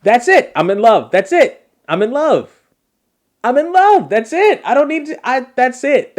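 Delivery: high, loud, head-over-heels gushing; excited, love-struck fan yelling high; smitten outburst. Real, not acted. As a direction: blurt it out like instant love. Host gushes over played accent clip.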